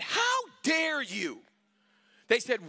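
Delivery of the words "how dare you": indignant. Retold or imitated. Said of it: "I looked at him and I said".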